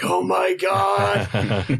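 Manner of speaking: drawn-out, high and slow; stunned oh my god. Retold or imitated. Telling his friends, replaying his stunned reaction. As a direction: let the surprise stretch out slowly. Stunned by VIP birthday card.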